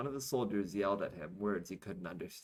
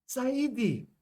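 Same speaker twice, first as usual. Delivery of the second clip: high, loud military call; loud address; 8 dB louder than usual. Acted. Soldier approaches the president saluting, loud. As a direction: sing out the title like a saluting soldier. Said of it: "a soldier said loudly".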